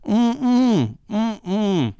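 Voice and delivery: high closed-mouth "mm-mm", imitated; mock wordless calming hums; mouthwash-muffled hum. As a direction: hum urgently with lips sealed round mouthwash. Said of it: "She had mouthwash in her mouth".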